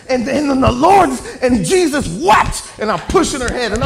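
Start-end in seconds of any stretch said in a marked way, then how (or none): 0.1-2.8 loud, high, hammed-up praying shout; overzealous prayer. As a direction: belt out a clumsy prayer with huge zeal. Re-enacts his overzealous novice prayer.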